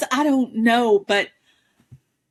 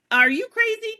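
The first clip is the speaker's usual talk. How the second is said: incredulous outburst; 12 semitones higher than usual. Retold or imitated. Imagined retort years ago.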